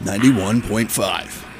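slow, smooth announcer read; lightly put-on radio host tone. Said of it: "You have a great radio voice".

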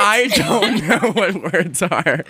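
high, drawn-out laughing admission; helpless laughter through the words. Real, not acted. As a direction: crack up at your own word slip. Laughing at own word slips.

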